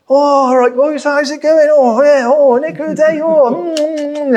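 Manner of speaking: high, loud cheery homeowner with his tea; cheerful customer sipping tea in front of the workers. Retold or imitated. Hammed-up customer greeting tradesmen while sipping his tea. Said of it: "And they'll go".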